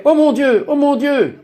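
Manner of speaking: very high, mock alarmed cry; retold crying out. Woman at the door falls back in shock, retold crying out. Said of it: "elle criait".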